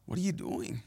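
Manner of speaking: hushed, gentle questioning; quiet, understated correction; soft reproof. Acted. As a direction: ask softly, as Jesus gently checking someone. Voices Jesus gently questioning him.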